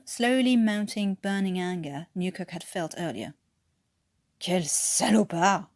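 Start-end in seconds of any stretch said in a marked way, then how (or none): 4.4-5.7 slow French oath under the breath; mild French mutter; French muttered curse. Acted. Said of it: "he muttered".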